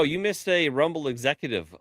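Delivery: ordinary delivery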